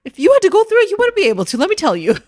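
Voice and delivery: high, loud teasing warning; mock warning. Real, not acted. To the men listening, a high loud teasing warning. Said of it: "teasing men, high and loud".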